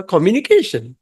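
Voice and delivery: ordinary delivery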